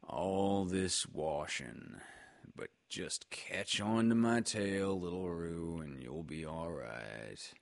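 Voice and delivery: low, slow, fading mumble of a glum donkey; gloomy grumble. A narrator's reading. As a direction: grumble it quietly to yourself, sinking away. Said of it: "grumbling quietly to himself".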